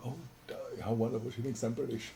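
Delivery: thinking it gleefully to himself; private delighted thought. Retold or imitated. Young man secretly pleased she thinks him British, hushed. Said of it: "I thought".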